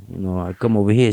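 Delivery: ordinary talk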